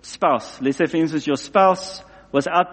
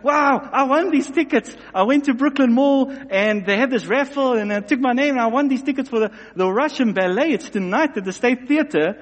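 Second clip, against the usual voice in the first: higher, bubbling over with news; excited wife; 6 semitones higher than usual. Acted. Wife bursting in with ballet tickets, excited and high. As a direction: gush it out like she can't contain it.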